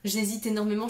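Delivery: ordinary talk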